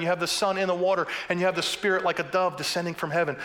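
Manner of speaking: ordinary talk, nothing marked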